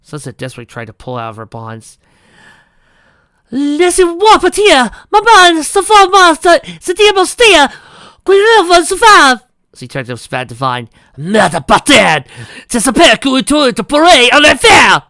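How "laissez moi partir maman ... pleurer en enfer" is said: shrieking high French in another voice; screaming in French. Acted. Sunset cursing her captor in French.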